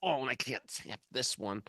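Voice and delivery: mock-anguished, high pleading that sinks at the end; hammed-up agony of wanting; mock whiny craving. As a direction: whine in mock desperation for a toy. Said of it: "It's not like they're like".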